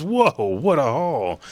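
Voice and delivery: high, slow awed pirate voice; amazed captain. Acted. Captain admiring the haul of treasure, awed and slow. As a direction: marvel at the treasure slowly. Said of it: "And he goes".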